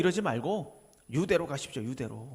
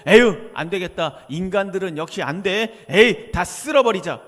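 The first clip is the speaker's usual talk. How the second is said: loud, heaved sigh into a fed-up outburst; hammed-up exasperation, punching the first words; exasperated giving up; 9 dB louder than usual. Preacher imagining Jesus giving up and wiping humans out, exasperated.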